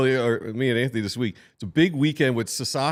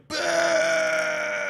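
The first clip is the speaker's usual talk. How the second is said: very high imitated little-girl shriek; mała dziewczynka. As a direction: screech like a heckling little girl. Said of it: "little girls, just like".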